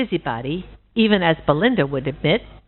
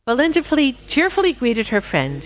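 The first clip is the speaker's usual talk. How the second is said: usual speaking voice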